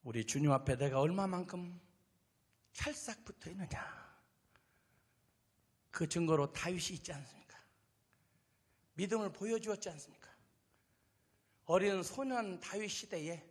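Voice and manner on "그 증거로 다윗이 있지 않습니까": normal talking voice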